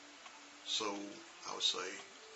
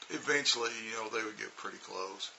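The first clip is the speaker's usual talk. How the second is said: normal talking voice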